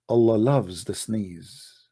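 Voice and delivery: ordinary delivery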